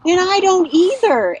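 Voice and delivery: very high, emotional cry; retold scream. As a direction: shout it in a soaring, high voice. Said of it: "I just started screaming to him".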